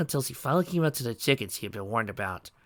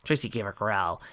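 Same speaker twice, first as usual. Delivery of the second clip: ordinary delivery, nothing marked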